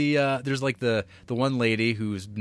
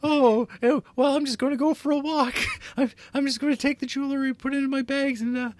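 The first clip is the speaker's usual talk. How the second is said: much higher, hammed-up falsetto lady; sing-song high falsetto feigning innocence; mock lady voice; 4 dB louder than usual. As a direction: play the guilty wife in a high squeaky put-on voice. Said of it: "And it's like".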